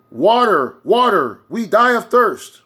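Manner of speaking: loud, high cry for help, slowing on the last words; a parched crew crying out for water; desperate call. Acted. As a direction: shout it across the water like a dying sailor. Distress signal from a ship.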